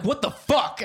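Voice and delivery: high, loud comic outburst; retold furious disbelief; exasperated anger. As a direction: shout it in mock outrage. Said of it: "made me so fucking mad".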